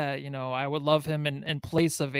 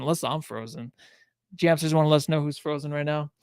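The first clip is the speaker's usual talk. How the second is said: normal talking voice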